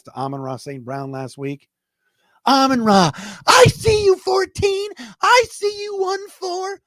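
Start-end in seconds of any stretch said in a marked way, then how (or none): 2.5-6.8 much higher, loud radio play-by-play shout; Dan Miller impression. Lions fan doing the radio call of a touchdown, excited shouting. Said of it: "That's my Dan Miller impression".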